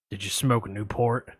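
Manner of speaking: lurk low like a shady caller; creepy caller. Retold or imitated. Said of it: "I'm gonna play both parts".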